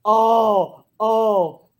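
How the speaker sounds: loud, high pained cry of oh; mock torn-up cry; anguished oh. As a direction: wail it like it hurts to choose. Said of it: "and I'm like".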